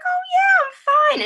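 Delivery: very high mock-reassuring voice; imitating breezy mother. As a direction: brush it off as Mom, way up high. Voices mother brushing off injury.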